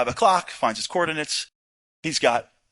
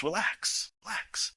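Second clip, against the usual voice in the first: soft calming; 2 semitones higher, 11 dB quieter, slower than usual. Retold or imitated. Commander calms him.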